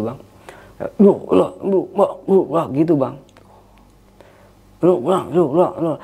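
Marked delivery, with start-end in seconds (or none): 1.0-2.6 higher-pitched wordless "lu-lu" babble; imitated chanting. Showing the unclear voices from below, imitated chanting. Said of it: "kayak bacaan apa gitu". 4.8-5.9 imitated babble in rising and falling syllables; indistinct chanting. Retelling voices from below the tent, indistinct chanting. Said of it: "Suaranya itu nggak jelas".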